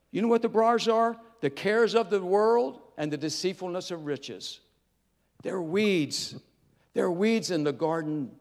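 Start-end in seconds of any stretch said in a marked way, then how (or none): none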